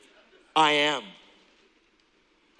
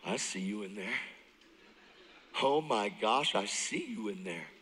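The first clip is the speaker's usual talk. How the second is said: much lower and softer, intimate; talking to the mirror; 7 semitones lower, 8 dB quieter than usual. Retold or imitated. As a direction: softly greet your reflection, low. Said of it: "You walk by the mirror and go".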